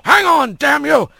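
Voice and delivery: high, urgent cry. A narrator's reading. Sergeant catching falling Rat mid-air, crying out. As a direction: cry out a desperate order. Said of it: "a cry of".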